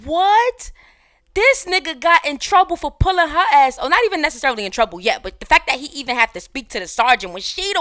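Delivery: loud, high-pitched disbelieving yelling; real astonished holler; incredulous outburst. Real, not acted. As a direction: shout it like you cannot believe what you just saw. Reacting in disbelief to the clip.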